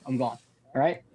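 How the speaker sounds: ordinary talk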